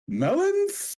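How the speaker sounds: high, ringing bardic cry; grand proclamation. Acted. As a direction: proclaim it to the crowd like a prophet. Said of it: "grand proclamations".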